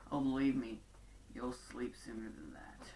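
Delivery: low, hushed, menacing warning; dark and gritty. Retold or imitated. Heckle walking away threatening Shelby, soft and dark. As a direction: drop the voice into an ominous hush. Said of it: "dark and, and gritty".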